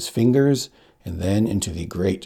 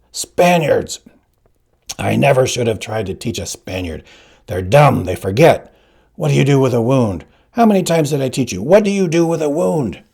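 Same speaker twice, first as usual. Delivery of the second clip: louder, higher, exasperated yelling; shouting teacher; 5 semitones higher, 6 dB louder than usual. Acted. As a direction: berate the pupil like a furious old teacher. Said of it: "McPherson was screaming again".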